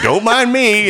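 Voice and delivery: high, loud casual hobo; high mock hobo voice. Retelling a hobo jumping in the pool, high and loud. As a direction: pitch it way up, breezy and loud. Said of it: "he was like".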